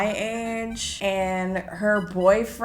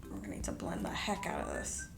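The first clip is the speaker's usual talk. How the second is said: whisper; 12 semitones lower, 13 dB quieter, faster than usual. Real, not acted. Reacting to too much powder.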